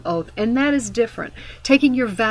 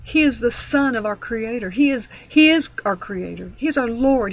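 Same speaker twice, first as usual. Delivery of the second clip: ordinary talk, nothing marked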